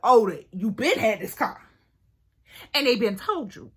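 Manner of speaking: loud, heated retort; getting mad. Retold or imitated. To the car guy caught by his friends, loud angry retort. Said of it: "So now I'm mad".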